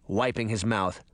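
plain reading voice

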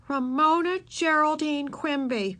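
slow, high full-name scolding. A narrator's reading. Big sister catching Ramona hiding, scolding. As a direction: space out the name in reproach.

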